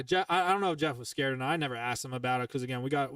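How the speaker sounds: normal talking voice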